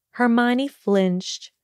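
ordinary delivery

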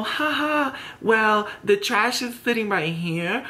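high, fake little laugh into a slow jibe; mock banter. Imitating a shady back-and-forth, high mock-amused taunt.

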